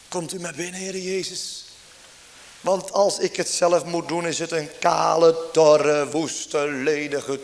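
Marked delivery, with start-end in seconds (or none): 0.1-1.6 soft, intimate invitation in prayer; hushed prayer. Acted. Believer softly inviting the Lord Jesus in, hushed prayer. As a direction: barely voice it, like a private prayer.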